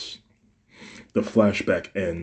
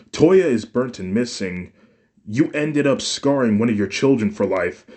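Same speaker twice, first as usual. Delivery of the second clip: high, loud and building to a shout; furious rant; 5 semitones higher, 5 dB louder than usual. A narrator's reading. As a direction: pile the charges on, getting louder. Son confronts mother angrily.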